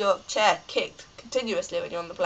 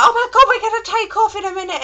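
high-pitched frantic passenger; mock panic; 12 semitones higher, 4 dB louder than usual. Passenger before take-off, high-pitched mock panic. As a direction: squeal it in exaggerated alarm.

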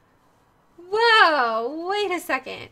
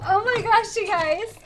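ordinary talk, nothing marked

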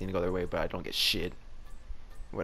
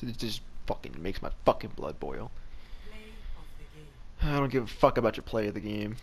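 ordinary talk, nothing marked

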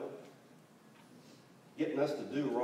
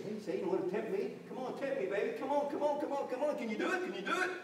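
high, quickening goading; mock bravado, egging on an opponent; cocky taunting; 10 semitones higher, faster than usual. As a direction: dare him to try it. Acting out cocky challenge to Satan.

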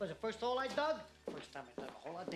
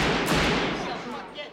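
triumphant shout; 11 dB louder than usual. Acted. Triumphant after the shot.

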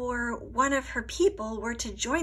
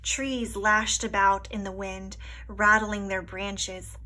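ordinary delivery, nothing marked

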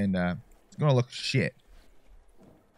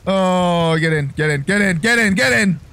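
louder, higher, rapidly repeated urging; panicked urging; 6 semitones higher, 7 dB louder than usual. Real, not acted. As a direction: urge it frantically, building louder and higher. Urging self to get in, scared.

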